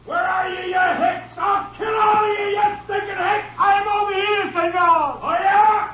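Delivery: high-pitched furious yelling; enraged shouting. Acted. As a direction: scream threats at an unseen enemy. Cornered outlaw rages at ranger.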